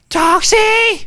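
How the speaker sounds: high, loud frantic scream; imitated mad yelling. Retelling Sarah yelling for the hero, frantic scream. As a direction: scream out his name like mad. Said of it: "literally yelling like mad".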